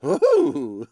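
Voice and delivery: high, loud mock ooh; imitating Chef's ooh. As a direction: squeal a hammed-up ooh like the chef. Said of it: "Chef's like".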